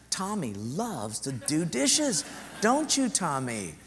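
put-on slow, syrupy teasing girl; sugary sister. Sister volunteers brother for dishes, slow sugary tease. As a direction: drawl it sweetly, with menace.

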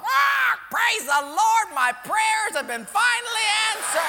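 high, loud squawk of joy; squawking parrot. Acted. Lady parrot rejoicing at Joe's come-on, loud high squawk. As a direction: shriek like a thrilled parrot.

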